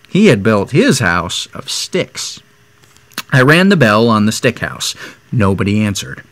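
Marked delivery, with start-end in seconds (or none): none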